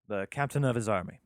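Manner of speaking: ordinary talk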